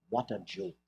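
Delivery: a hint of a condescending sneer; lofty condescension. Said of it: "a lofty air of condescension".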